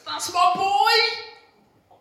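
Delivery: a proud father bursting with joy; excited shout. Pretend. God cheering at his son's baptism, excited shout. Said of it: "God in his excitement goes".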